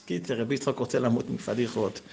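ordinary delivery

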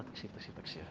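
very soft, near-whispered appeal; quietly calm an excited listener; hushed, drop right down; acted hushing. Acted hushing reply after protest.

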